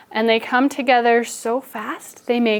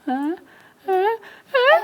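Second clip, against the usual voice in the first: normal talking voice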